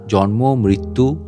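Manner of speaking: ordinary delivery, nothing marked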